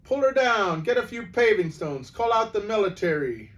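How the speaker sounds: loud, high shouts of an outraged mob; angry roar. Acted. Crowd turning on the witch, angry shouting. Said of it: "There came an angry roar from the crowd".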